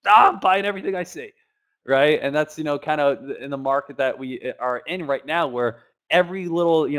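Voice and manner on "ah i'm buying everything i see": loud, high "Ah, I'm buying everything I see"; greedy eager buyer. Retold or imitated. Mocking traders who buy every hyped stock, loud and eager. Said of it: "now it's just like".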